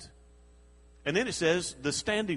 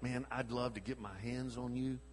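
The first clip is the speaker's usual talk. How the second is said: low, soft seething threat under the breath; seething mutter; 4 semitones lower, 10 dB quieter than usual. Retold or imitated. Pastor voicing Samson, holding rage in, quiet seething threat. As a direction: hold the rage in, barely said. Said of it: "been so mad that you just sat there".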